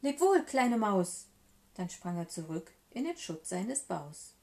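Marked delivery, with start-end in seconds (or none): 0.0-1.3 louder, slow drawn-out goodbye; storybook cry of farewell; called farewell. A narrator's reading. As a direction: call back as the fleeing fox. Fox calls farewell while fleeing.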